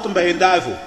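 ordinary talk, nothing marked

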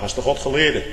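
ordinary talk, nothing marked

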